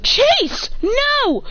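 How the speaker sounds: yelling at the dog. Real, not acted. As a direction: shout the dog's name to stop him.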